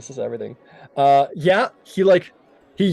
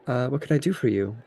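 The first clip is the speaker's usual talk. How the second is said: lightly voiced elf healer, a touch softer; a hint of a gentle elven shopkeeper; 3 semitones lower, 5 dB quieter than usual. Healer greeting a wounded traveler, lightly voiced. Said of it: "and she says".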